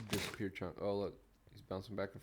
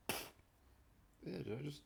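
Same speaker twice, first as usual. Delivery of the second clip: just swallowed a bug; 7 semitones higher, 8 dB quieter, much slower than usual. Real, not acted. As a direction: caught off guard by a bug in the mouth. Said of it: "a gnat flew in my mouth".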